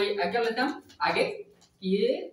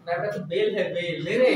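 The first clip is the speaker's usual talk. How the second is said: laughing through the punchline; amused; 4 dB louder than usual. Real, not acted. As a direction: deliver the joke with a laugh breaking in. Teacher jokes about suitor as ox.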